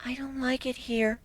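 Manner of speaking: high, breathless whisper; timid whisper. A narrator's reading. Fluttershy whispers her fear of the wasteland. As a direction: whisper it like a frightened mouse. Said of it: "Fluttershy stated".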